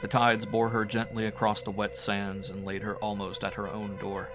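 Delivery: ordinary delivery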